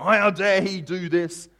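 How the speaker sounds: loud, high, drawn-out outrage; acted fury, stretching the words; fuming David. David reacting to Nathaniel's story, fuming. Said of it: "David's fuming at the mouth".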